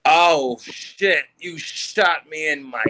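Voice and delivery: mimicked slow-motion cry of pain; imitating injured teammate. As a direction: drag the words out, then hit the last one high. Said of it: "this is exactly what, how he said it".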